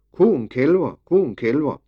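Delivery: lightly breathless, urgent call; breathless shout. A narrator's reading. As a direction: call out the alarm, slightly out of breath. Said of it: "råbte hæsblæsende".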